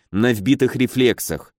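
ordinary delivery, nothing marked

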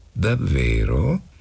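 slow, drawn-out doubt; sceptical question. A narrator's reading. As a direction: stretch it out as if unconvinced. Mistress doubts the boy's promise.

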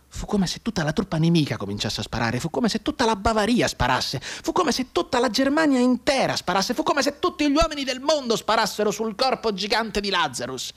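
from narration up to a high, slowed cry; rising intensity. A narrator's reading. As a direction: build the barrage to a peak. Building volley of enemy fire.

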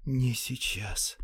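slow drawn-out whisper. A narrator's reading. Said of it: "прошептал он".

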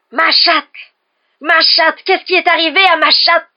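high, very loud screamed outcry; frantic shriek over a beloved cat; horrified howl. A narrator's reading. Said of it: "hurla-t-il".

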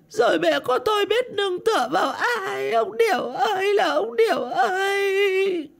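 high-pitched, drawn-out funeral wail. A narrator's reading. Widow at her husband's coffin, wailing. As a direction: cry out loudly, stretching the calls in grief. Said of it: "đoạn gào lên".

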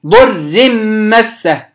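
ordinary talk

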